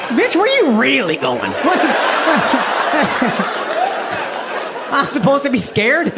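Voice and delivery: mocking, laughing scoff; smug tease at a storming-out wife; mock dismissive husband; unbothered husband. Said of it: "I'm just like".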